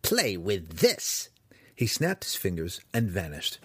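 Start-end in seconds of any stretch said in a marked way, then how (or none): 0.0-1.3 slow, gloating challenge; triumphant. Acted. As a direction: relish each word as you spring the trap. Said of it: "the wizard yelled triumphantly".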